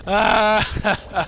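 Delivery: high, breathy fake laughing fit; imitated burst of helpless laughter; acted cracking up. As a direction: laugh in the villain's face. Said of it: "I just start cracking up".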